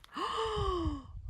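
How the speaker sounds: a sharp, high gasp of delight. Real, not acted. Gardener spots her first dahlia, high delighted gasp.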